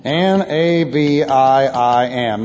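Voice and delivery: drawn-out dictation of single letters; spelling out. Real, not acted. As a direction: spell it slowly for note-takers. Spells out Hebrew term.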